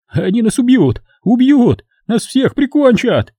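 high, rising terrified wail; panicked crying out. A narrator's reading. Lindberg collapsing in the trench, high panicked wail. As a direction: break down in panic. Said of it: "Он плакал и кричал".

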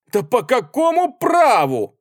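high, loud, drawn-out outburst; indignant exclamation. A narrator's reading. Artskhanov, boiling with indignation, exclaims at the soldiers, outraged. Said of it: "воскликнул он".

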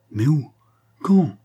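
urgent questions, jumping high and loud; trembling with fury. A narrator's reading. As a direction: fire off the questions in narrated rage. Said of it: "tremblant de fureur". (tremblant de fureur)

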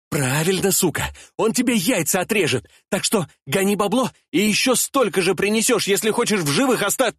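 high, fast, boastful threats; cocky threatening kid. Acted. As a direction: brag and threaten, cut off.

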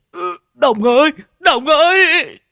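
high, loud drawn-out scream of a name; terrified howl. A narrator's reading. Rider about to crash into his friend, howling. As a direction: howl it out, stretching the last word. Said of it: "mà rú lên".

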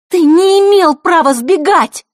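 high, loud, slow furious scolding; fiery outraged shout; heated. A narrator's reading. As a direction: blow up at him. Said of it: "распалилась Василиса".

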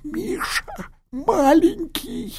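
very high, slow, pained crying; delirious dying man. Acted. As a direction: cry out in delirium.

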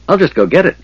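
high-pitched, light cheerfulness; cheerful. A narrator's reading. Tass to wounded Sturm, cheerfully offering. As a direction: chirp it lightly, pitched well up. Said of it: "Tass said cheerfully".

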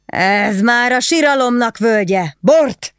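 high, loud wail, then bark for wine; howling for wine. A narrator's reading. Drunk husband wails and demands wine, loud. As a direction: cry out in drunken misery. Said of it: "üvöltött fel".

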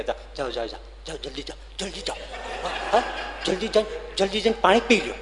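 hurried, laughing urging to go quickly; shooing him off with a chuckle. Retold or imitated. Telling the thirsty man to hurry home, laughing urging.

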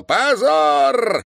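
high, loud, long-held cry; full-lunged protracted shout of condemnation; drawn-out shout. A narrator's reading. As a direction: bellow it with a stretched vowel. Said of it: "протяжно закричал".

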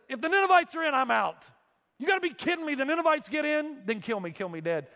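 high, loud outraged protest; indignant Jonah. Acted. Jonah refusing heaven if the Ninevites get in. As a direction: throw a tantrum at God.

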